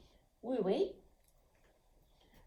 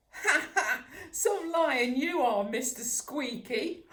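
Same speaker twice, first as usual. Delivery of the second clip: higher, louder jeering laugh; mocking laugh; 5 semitones higher, 6 dB louder than usual. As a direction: sneer and laugh at the little dog. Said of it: "sniggering noise and then a rude snort".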